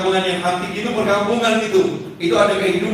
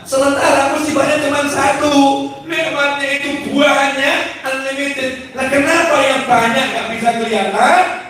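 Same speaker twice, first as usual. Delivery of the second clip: high-pitched, insistent sermon delivery; impassioned preaching; 9 semitones higher than usual. Real, not acted. Preacher urging listeners to stop complaining about hardship, impassioned. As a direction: exhort the crowd with raised voice.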